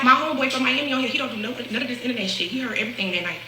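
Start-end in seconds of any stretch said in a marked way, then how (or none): none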